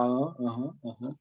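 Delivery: soft, low noncommittal uh-huhs; reticent phone mumbling. Retold or imitated. Recalling shy phone calls where they barely spoke, reticent uh-huhs. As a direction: trail off softly in minimal phone replies. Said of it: "Ligavam pra mim e ficava".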